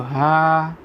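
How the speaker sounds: હા held long so the trailing aa rings out; drawn-out syllable demo. Shown as a demonstration. Teacher asking what vowel follows, હા drawn out. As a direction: stretch હા on a level note, exposing its vowel. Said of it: "તો એની જોડે શું બોલાશે?".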